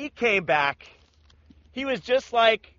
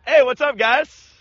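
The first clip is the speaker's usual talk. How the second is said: louder, higher, breezy hey what's up; upbeat greeting; 5 semitones higher, 9 dB louder than usual. Retold or imitated. Jesus greets the apostles, loud cheerful hello. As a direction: greet old friends cheerfully.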